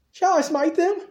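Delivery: hammed-up bloodthirsty eagerness; eager king. King eager to kill enemy.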